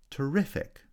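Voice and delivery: "Terrific" with a high, strong second syllable; second-syllable stress. Shown as a demonstration. Teacher showing stress shift, second-syllable stress on "Terrific". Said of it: "stress on the second syllable".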